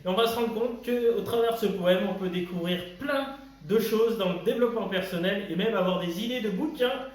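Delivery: video intro. Acted. Host greeting viewers and announcing the poem, upbeat video intro. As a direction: punch out the welcome like a host.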